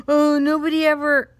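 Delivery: loud, high-pitched mock whine; hammed-up sulky protest; whining kid. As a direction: imitate a kid's wailing complaint. Imitating upset son's complaint.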